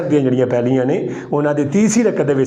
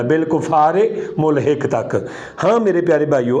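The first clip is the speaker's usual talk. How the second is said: ordinary delivery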